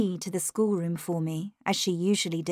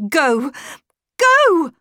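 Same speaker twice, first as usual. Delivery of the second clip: loud command, jumping higher and louder; shouted order; 8 semitones higher, 8 dB louder than usual. A narrator's reading. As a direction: shout to send them away, building. Governess orders them to leave.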